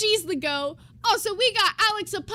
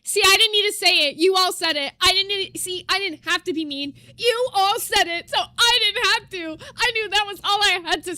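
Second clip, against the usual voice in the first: loud, laughing told-you-so; laughing gloat; 3 semitones higher, 5 dB louder than usual. Real, not acted. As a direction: crack up while gloating.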